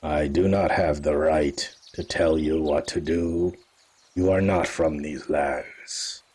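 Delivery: a grave cat-folk elder warning strangers; Kerber speaking. Acted. Kerber replies to the player.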